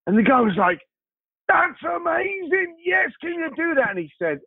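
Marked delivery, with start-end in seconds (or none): 1.5-3.9 thrilled, much higher, with a burst of delight; excited shout. Retold or imitated. Customer gushing to the baker, excited high shout.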